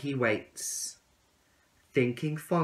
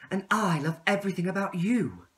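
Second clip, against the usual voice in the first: higher, sweet caterpillar voice; a lovestruck little caterpillar, pitched up; 8 semitones higher, 4 dB louder than usual. Acted. Caterpillar answering the tadpole, higher and sweet. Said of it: "said the caterpillar".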